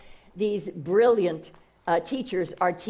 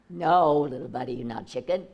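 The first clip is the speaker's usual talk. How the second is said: gentle big eagle; 9 semitones lower than usual. Acted. Big eagle corrects baby eagle.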